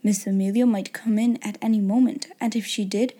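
plain reading voice

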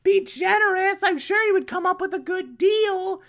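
very high put-on female falsetto; sing-song femme fatale, pitched right up; Octavia. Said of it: "she's like".

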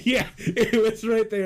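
high, loud agreement through real laughter; laughing. Real, not acted. Host agreeing with co-host's cartoon joke, laughing. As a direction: laugh out the words slowly.

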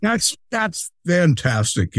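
high, slow put-on delight; drawn-out, high-pitched enthusiasm; enthusiastic. Shown as a demonstration. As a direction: gush over it slowly for a child. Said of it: "my enthusiastic".

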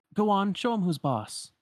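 high, encouraging call. Retold or imitated. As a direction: cheer a friend on from afar. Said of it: "Sora calls out".

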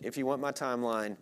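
ordinary talk, nothing marked

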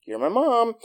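the kid pleading with his mother, a light mock child voice; kid insisting. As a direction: insist like a stubborn little boy. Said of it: "And he goes".

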